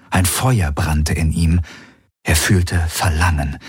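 ordinary delivery, nothing marked

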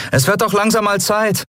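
high-pitched call across engine noise; calling back. A narrator's reading. Endriel calling back to departing admiral, high call. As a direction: throw your voice up high to be heard. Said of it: "rief Endriel zurück".

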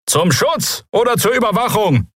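high-pitched shouted question; heckling call. A narrator's reading. Anonymous citizen challenges the captain, calling out. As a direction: fling the question from the crowd. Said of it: "rief jemand".

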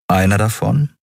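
plain reading voice

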